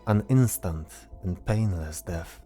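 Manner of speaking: ordinary delivery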